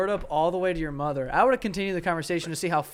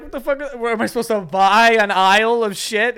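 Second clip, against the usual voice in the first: raised, higher-pitched exasperation; mock indignant outburst; indignant inner rant; 5 semitones higher, 7 dB louder than usual. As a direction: act out a friend's outraged thoughts. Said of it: "he's like, in his head".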